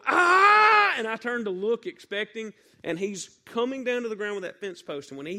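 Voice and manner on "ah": very high, very loud cry of effort; dad's battle yell. Retold or imitated. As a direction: yell as you swing down the fence post. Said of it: "I hear him go".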